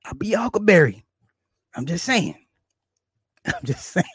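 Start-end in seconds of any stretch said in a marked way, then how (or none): 3.0-4.1 squeaky laugh breaking through the words; real giggle under the line. Real, not acted. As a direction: let the laugh take over, much higher and softer. Laughing at his own threat.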